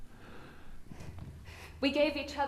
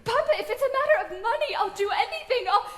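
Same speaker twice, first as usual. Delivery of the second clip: tearful, higher-pitched begging; desperate pleading; 4 semitones higher than usual. Acted. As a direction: plead urgently, voice climbing toward tears. Daughter begs not to marry.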